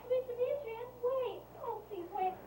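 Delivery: high, soft begging call; pleading. Acted. Lovesick woman chases the man who flees her, pleading. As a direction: plead with him to wait.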